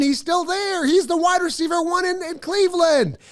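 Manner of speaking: high, excited gush; thrilled disbelief. Real, not acted. Spotting Jarvis Landry still available, high excited gush. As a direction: sound amazed he's still on the board.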